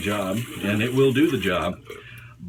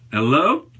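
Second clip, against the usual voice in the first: calling out; 3 semitones higher, 7 dB louder than usual. Real, not acted. Calling out to someone outside.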